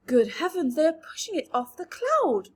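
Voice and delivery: loud, startled exclamation; alarmed cry. A narrator's reading. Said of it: "cried James".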